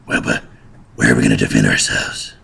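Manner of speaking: a peasant pondering where to defend themselves, in a deep put-on voice. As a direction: play a dim-witted peasant in a low voice. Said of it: "says one bat peasant".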